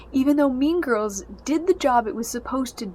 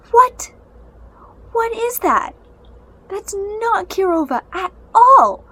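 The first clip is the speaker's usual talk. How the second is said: high, loud cry of disbelief; a much higher, louder incredulous outburst; outraged disbelief; 10 semitones higher, 11 dB louder than usual. Real, not acted. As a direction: shout it out, appalled at what they did to her. Outraged at the film's Kirova.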